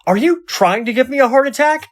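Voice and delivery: high, loud startled outburst; startled shout. Acted. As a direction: yell it, heart still pounding. Startled by robot, yells.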